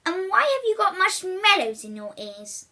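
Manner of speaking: high, loud exasperated scolding; scolding mother. Acted. Mother scolding William about the marshmallows, loud and high. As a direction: demand it like a cross mum.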